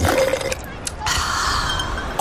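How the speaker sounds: blissful sigh. Acted. Dreamy cruise daydream.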